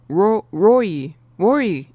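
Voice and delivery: "Roy" tried out as row plus ee, run together; tentative pronunciation. Shown as a demonstration. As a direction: try blending row and ee into "Roy". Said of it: "Is that it?".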